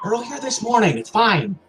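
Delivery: high-pitched brush-off; breezy dismissal. Acted. Kenneth reassuring Maya about the snowball, high breezy dismissal. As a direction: pitch it high and wave the worry away.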